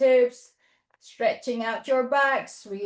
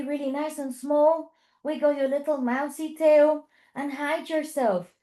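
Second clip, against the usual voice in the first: normal talking voice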